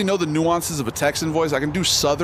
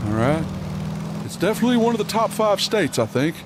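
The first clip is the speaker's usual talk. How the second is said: higher cartoon Texan voice; proud Texan dad, pitched up and swinging; Hank Hill; 3 semitones higher than usual. Streamer doing a Texan voice for chat, Hank Hill impression. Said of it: "like, a Hank Hill".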